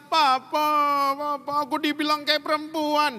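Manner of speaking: put-on high pleading voice of a boy crying to his father; mildly voiced weeping boy. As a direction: plead with papa, near tears. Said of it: "dia nangis".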